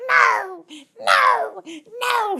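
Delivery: high-pitched hammed-up wail; imitated tearful shriek; mock screaming and crying. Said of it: "She's screaming and she's crying".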